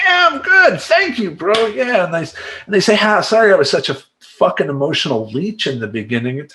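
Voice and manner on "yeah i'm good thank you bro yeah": high-pitched, eager reassurance; mock upbeat client. Client thanking the coach who checks on him, high eager voice. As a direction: voice a newly confident client, pitched way up.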